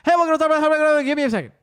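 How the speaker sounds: loud, high, words smeared into one droning blur; garbled drive-thru speaker. Retold or imitated. Drive-thru worker stalling a customer, garbled speed-talk. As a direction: jabber it on one high note, unintelligibly. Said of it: "the guy talks like that".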